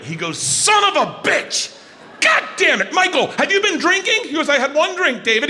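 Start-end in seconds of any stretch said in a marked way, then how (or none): 0.4-4.2 high, shouted scolding of a partner; irate. Retold or imitated. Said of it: "David gets irate". 4.5-5.5 high-pitched quarrelling retort; defensive partner. Retold or imitated. Acted quarrel between two dads.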